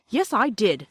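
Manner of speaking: loud, angry retort; angrily. A narrator's reading. Peter defending himself to his scornful sister, loud and angry. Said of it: "said Peter angrily".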